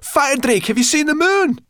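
high, loud panicked call; shrieking alarm; terrified shriek. A narrator's reading. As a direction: cry out in terror to the dragon. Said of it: "gave a terrified shriek".

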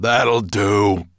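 loud dragon bellow. A narrator's reading. Dragon stopping his polisher, bellowing. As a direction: bellow to halt the work. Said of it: "bellowed Nettlebrand".

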